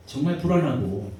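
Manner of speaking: ordinary talk, nothing marked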